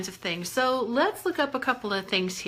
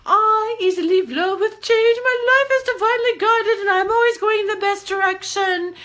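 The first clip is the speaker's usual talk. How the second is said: much higher and louder, squeaky; 10 semitones higher, 6 dB louder than usual. Shown as a demonstration. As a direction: say it way up high like a cartoon. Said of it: "changing my voice like that".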